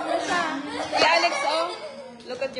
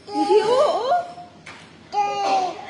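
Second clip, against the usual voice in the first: calling out; 6 semitones higher, 5 dB louder, much slower than usual. Real, not acted. Mother calling her baby Miggy, loud high call.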